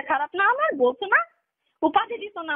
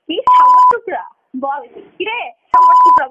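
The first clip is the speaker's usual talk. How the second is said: a loud, much higher scream of the pet name; mocking scream; 9 semitones higher, 13 dB louder than usual. Real, not acted. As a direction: scream the endearment bitterly. Girlfriend screaming during phone quarrel. (ব্যঙ্গ করে চিৎকার)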